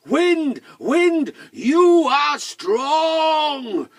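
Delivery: high, loud shout into the wind; big storybook shout; calling out. Acted. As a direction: shout to the wind, holding the last words very long. Tom calls to north wind.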